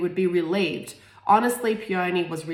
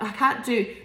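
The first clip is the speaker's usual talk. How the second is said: ordinary delivery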